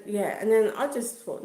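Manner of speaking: normal talking voice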